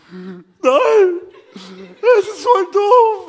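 very high, loud, drawn-out wail; mock tearful whine. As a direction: wail like a thwarted child, hammed-up. Wife whining in tearful despair. (übertrieben weinerliches Jammern)

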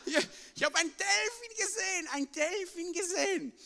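high excited call, laughing relief. Retold or imitated. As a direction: call out the news to Pedro. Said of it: "hab gerufen".

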